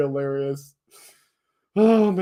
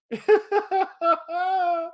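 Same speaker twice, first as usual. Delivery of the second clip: squealing laugh; real helpless giggling; high giggle; 12 semitones higher than usual. Real, not acted. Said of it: "making me laugh".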